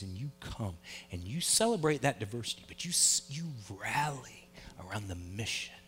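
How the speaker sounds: low, soft, earnest sermon voice; hushed preaching. Acted. Pastor urges the church to unite, soft earnest preaching. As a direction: draw the congregation in quietly.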